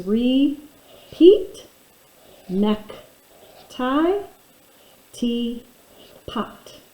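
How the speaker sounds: "Repeat", "neck", "tie", "teapot" each read as a separate demo item; scooping syllables. Shown as a demonstration. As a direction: scoop each word for the class. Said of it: "I can scoop 'em like this".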